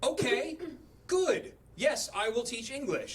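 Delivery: nervous high-pitched agreement to a scary customer; nervous squeak. Retold or imitated. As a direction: voice climbing high and tight with nerves. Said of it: "And I'm like".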